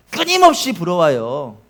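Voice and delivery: ordinary talk, nothing marked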